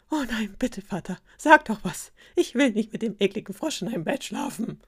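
lightly voiced protesting princess; pleading princess. A narrator's reading. Princess begs her father to intervene, pleading. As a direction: appeal to father with a touch of desperation.

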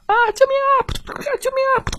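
very high squealing cries; fish screaming for help. Acted. Goldfish circling the bear, screaming high for help. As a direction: shriek for help like a tiny fish.

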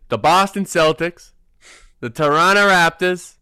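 lightly put-on Boston accent. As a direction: announce the teams with a playful Boston accent. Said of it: "I got started on this Boston accent".

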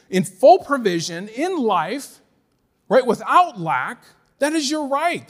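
emphatic, rising sermon delivery; forceful, declarative pulpit voice; impassioned preaching. Real, not acted. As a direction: drive each truth home to the congregation.

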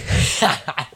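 short unvoiced giggle; little breathy laugh. Real, not acted. Giggles at saying the name.

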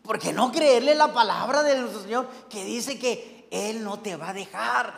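ordinary talk

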